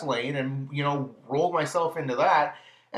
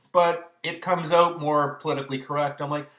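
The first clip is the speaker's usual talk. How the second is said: usual speaking voice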